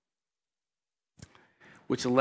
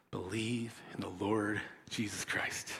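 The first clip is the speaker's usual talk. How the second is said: much softer, slow, low reply; gentle answer; 5 semitones lower, 15 dB quieter than usual. Retold or imitated. Paul answering the jailer, soft low reply. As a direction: say it softly and gently. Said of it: "Paul's response is".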